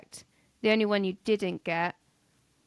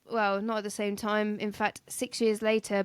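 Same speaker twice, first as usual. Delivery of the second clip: ordinary talk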